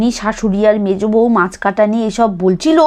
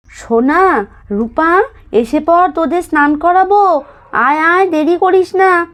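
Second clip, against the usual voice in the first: put-on calling the kids; 6 semitones higher than usual. Calling children for bath.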